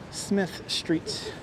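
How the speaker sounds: a soft breathy whisper of the street name. Shown as a demonstration. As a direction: whisper "Smith Street" under your breath.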